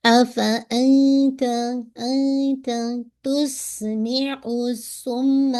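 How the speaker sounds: slow, drawn-out tajweed recitation with a clear whistling س in تُسْمِعُ; slow Quranic recitation. Shown as a demonstration. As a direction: recite it slowly, letting the س whistle. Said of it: "تس سین کی ویسل sound واضح ہو". (تُسْمِعُ کی س کی سیٹی واضح کرتے ہوئے آہستہ تلاوت)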